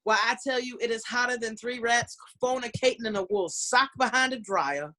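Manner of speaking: hammed-up Southern belle accent; Savannah Southern. Entertainer complaining of heat to Portlanders, hammed-up Savannah Southern. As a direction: play up the Southern twang for effect. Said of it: "Savannah Southern".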